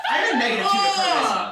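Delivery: high, loud, laughing. Real, not acted. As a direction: laugh through the line. Laughing at own character.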